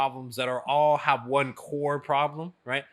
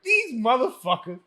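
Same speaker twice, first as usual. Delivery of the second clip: louder, higher indignant rant through a laugh; mock indignant grumble; 6 semitones higher, 8 dB louder than usual. To his friends, imitating himself griping at home, laughing indignant rant. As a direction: huff about nosy friends, half laughing.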